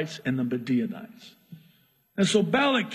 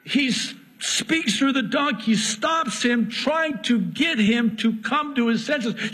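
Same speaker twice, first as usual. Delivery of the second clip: animated retelling; 8 semitones higher than usual. Retold or imitated. Acted argument with donkey, raised pitch.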